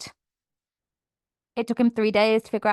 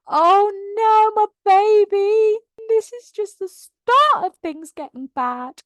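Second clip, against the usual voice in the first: heartbroken fan; 10 semitones higher, slower than usual. Retold or imitated. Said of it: "you're like".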